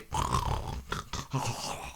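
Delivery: rough, voiceless mock snoring; long breathy snore noise; imitated snore. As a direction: snore like someone out cold. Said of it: "you can hear the".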